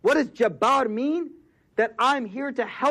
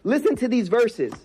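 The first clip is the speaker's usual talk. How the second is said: normal talking voice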